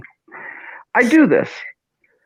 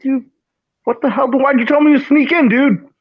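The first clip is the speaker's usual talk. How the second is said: mock outrage; 9 semitones higher than usual. Retold outrage at friend.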